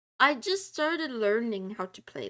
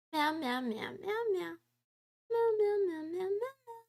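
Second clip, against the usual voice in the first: cat meows; 7 semitones higher than usual. Pretend. Meowing like a cat at chat.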